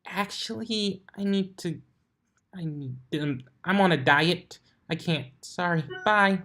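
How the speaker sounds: high, halting, nervous excuse. A narrator's reading. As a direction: stammer an excuse and flee. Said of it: "forced a smile through her terror".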